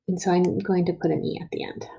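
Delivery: ordinary delivery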